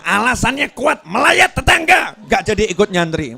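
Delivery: excited. Real, not acted. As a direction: land the joke with rising glee. Khotbah bersemangat, tertawa. (bersemangat, lebih tinggi dan keras)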